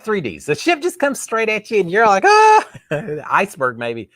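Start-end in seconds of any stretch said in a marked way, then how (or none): 2.2-2.7 high, loud comic "Ah!"; short hammed-up scream of alarm; mock startled scream. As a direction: shriek as if it's coming at you. Imagined viewer startled by oncoming ship.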